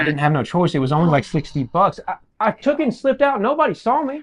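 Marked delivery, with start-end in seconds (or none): 2.1-4.2 very high, squirming excuse; high-pitched self-justification; defensive pleading. Acted. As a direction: plead your case in a high voice. Dale defends taking the money.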